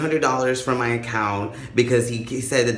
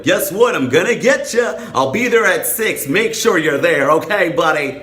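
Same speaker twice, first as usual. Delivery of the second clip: high, loud cartoonish taunt; mock friendly warning; 5 semitones higher, 5 dB louder than usual. Mocking a caller who warns the criminal, high mock taunt. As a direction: call it out in mock-chummy sing-song.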